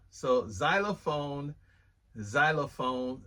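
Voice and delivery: ordinary delivery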